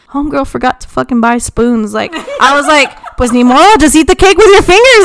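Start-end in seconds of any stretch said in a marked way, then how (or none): 3.2-5.0 higher, louder mock resignation; acting out a fed-up bride; exasperated shrug-off. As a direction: toss it out like a hopeless punchline. Retold exasperated reply to a guest.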